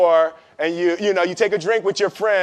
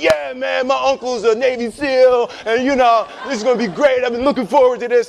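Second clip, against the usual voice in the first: higher, lightly hammed-up cocky buddy; fake bravado; 4 semitones higher than usual. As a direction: brag like a friend hiding fear. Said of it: "pretending like they didn't".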